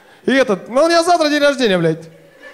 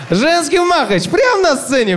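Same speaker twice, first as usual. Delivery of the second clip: hype; 8 semitones higher, 4 dB louder than usual. Acted. Hyping the fight.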